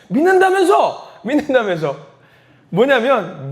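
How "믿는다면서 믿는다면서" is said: high, drawn-out accusing cry, easing on repeat; taunting shout. Acted. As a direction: throw it loudly in a friend's face. Friend taunts him about his faith. (놀리듯 높게 외쳤다가 잦아드는 말투)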